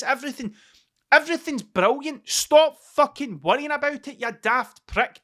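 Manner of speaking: fired-up self pep talk. Retold or imitated. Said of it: "is what I said to myself".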